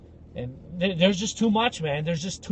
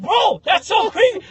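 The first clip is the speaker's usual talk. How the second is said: much higher, louder freaked-out cry; freaked-out shout; 8 dB louder than usual. Retold or imitated. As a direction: yell it in alarm. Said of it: "I was like".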